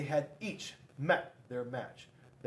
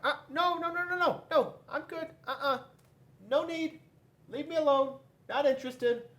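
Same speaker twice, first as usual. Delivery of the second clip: much higher, fast nervous no's; squeaky nervous refusal; 4 dB louder than usual. Acted. Paper refuses Rock's challenge, high nervous refusal. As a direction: back away politely in a squeaky voice.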